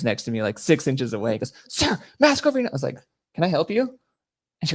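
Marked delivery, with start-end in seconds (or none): 1.7-2.7 high-pitched, loud mock official; imitated insistent yell. Retold agent ordering up close. 3.3-4.0 higher-pitched, mock-oblivious question; feigned innocence. Feigning ignorance to the agent.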